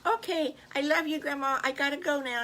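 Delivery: put-on chirpy little girl taking her leave.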